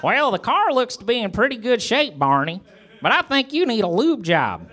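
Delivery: high, lilting character voice; Gomer voice. A narrator's reading. Gomer advising Barney about the car, high character voice.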